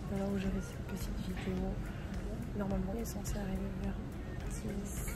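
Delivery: soft, hushed voice; quiet voice. Real, not acted. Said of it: "Je parle doucement".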